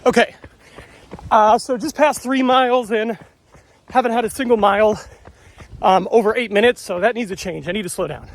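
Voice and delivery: talking mid-run, a bit winded; while running. Real, not acted. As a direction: speak while jogging along. I'm holding this phone, talking to you.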